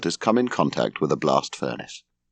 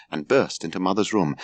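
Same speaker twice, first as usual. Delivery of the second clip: ordinary delivery, nothing marked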